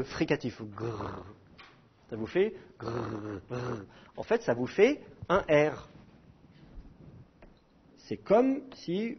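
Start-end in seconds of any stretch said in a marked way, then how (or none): 0.6-3.8 soft throaty "grrr" rasps; rubbed g sound. Shown as a demonstration. Teacher has students try the rubbed g in the throat. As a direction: scrape air at the back of the throat on "grrr". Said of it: "ensuite de frotter un peu".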